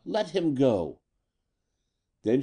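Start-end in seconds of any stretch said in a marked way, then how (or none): 0.1-1.0 higher-pitched demand at normal volume; an understated cry of command; mild narrated shout. A narrator's reading. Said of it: "she shouted at Cadorna".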